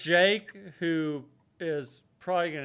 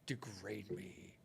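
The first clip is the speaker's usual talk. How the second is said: mock sultry murmur; 3 semitones lower, 18 dB quieter than usual.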